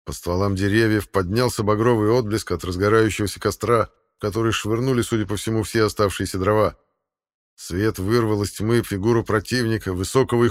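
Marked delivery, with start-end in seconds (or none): none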